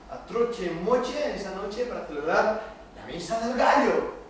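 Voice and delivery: ordinary delivery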